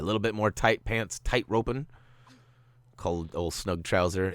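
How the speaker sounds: normal talking voice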